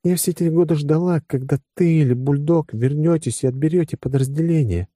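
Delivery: high-pitched female character voice; confessing woman. Acted. Woman admitting years of waiting, high voice.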